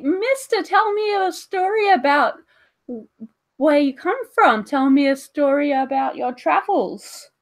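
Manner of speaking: put-on high, loud child voice begging; pleading kid. Child begging the giant for a story, high and eager. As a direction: beg like a little kid wanting a story.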